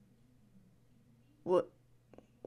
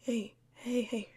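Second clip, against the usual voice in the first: normal talking voice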